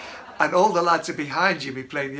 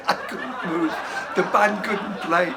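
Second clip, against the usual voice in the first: laughing; 4 semitones higher than usual. Real, not acted. Laughing while recounting.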